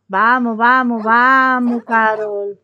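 calling out loud, slowing down the repeated vamos; loud, slow urging of a slow Sim. Pretend. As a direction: hurry her along with a loud, drawn-out call.

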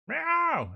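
high, loud meow; cat yowl. Acted. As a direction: let out a piercing cat cry. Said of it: "And the cat is going".

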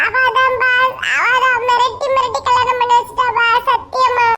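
ordinary talk

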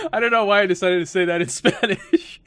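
high, laughing through the words. Real, not acted. Gamer amused at own Spanish reply, laughing. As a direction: crack up while admitting it.

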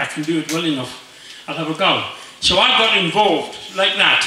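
ordinary talk, nothing marked

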